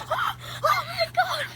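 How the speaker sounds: high panicked crying out; frightened gasp and shout. Pretend. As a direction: gasp then yell to run. Beast found again.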